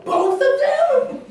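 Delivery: high, loud disbelieving "both of them?"; surprised friends. Retold or imitated. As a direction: mimic friends' shocked disbelief, laughing. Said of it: "They would always go".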